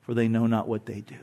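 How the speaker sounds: quiet tender plea; hushed prayer. Retold or imitated. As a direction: let the prayer trail off gently. Jesus' words on the cross.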